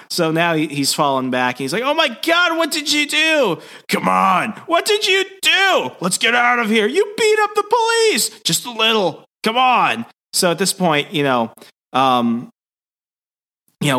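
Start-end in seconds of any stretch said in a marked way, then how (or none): 1.8-10.0 very high-pitched frantic exclaiming; hammed-up comic disbelief and alarm; mock panicked yelling. Flustered hero scolding his partner over beating up police, mock panicked yell.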